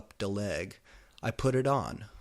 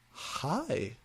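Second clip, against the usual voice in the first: flirty; 7 semitones higher than usual. A narrator's reading. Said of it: "I said flirtily".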